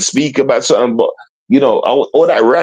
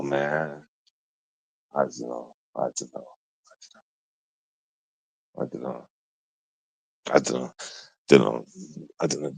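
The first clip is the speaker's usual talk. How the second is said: barely audible; 11 semitones lower, 14 dB quieter than usual. Real, not acted.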